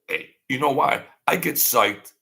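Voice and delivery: ordinary delivery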